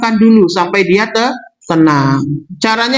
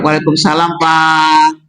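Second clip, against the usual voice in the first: low, calming wifely greeting; soothing reply; 5 semitones lower than usual. Acted. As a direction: answer in a lower voice to settle him down. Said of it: "Enggak, menenteramkan".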